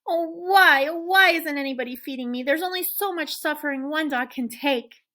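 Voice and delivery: high, loud hammed-up wail of woe; moaning dog lament. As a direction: play the suffering martyr dog. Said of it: "roll onto my back and moan".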